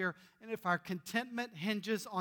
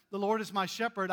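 psalm recited; 5 dB louder than usual. Shown as a demonstration. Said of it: "the psalm says".

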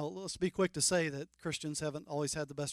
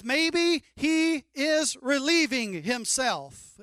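slow, higher, louder trash talk; crude mocking; 7 semitones higher, 6 dB louder, much slower than usual. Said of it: "a little bit crude".

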